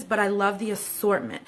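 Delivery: usual speaking voice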